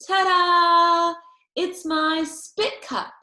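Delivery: loud, high, proud ta-da; girl showing off her spit cup to a friend; Junie B ta-da. Acted. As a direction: present it like a magic trick. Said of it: "I put it right on his lap".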